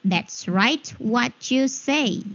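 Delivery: slow, word-by-word demo; slow repeat. Shown as a demonstration. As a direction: draw each word out for learners. Teacher repeats the phrase slowly.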